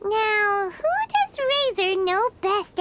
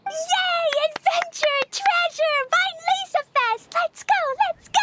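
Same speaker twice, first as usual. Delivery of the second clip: excited cheering; 7 semitones higher than usual. Acted. Child cheering about the adventure.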